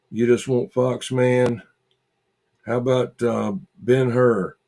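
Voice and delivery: ordinary talk, nothing marked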